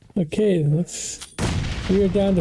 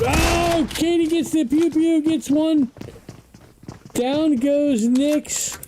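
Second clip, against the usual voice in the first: much higher, thrilled play-by-play; excited call; 10 semitones higher, slower than usual. Real, not acted. Caster hyping Katie's clutch kill for viewers, high and thrilled. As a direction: ride the rising hype as she gets the kill, stretching the last line slowly.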